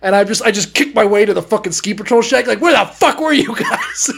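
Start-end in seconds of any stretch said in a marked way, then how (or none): 2.6-4.1 high-pitched mock outrage; pissed-off demand. Kid bursting into the ski patrol shack, outraged demand. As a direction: demand answers in comic fury. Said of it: "I'm so fucking pissed".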